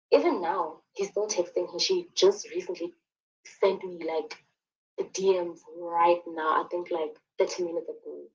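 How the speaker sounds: normal talking voice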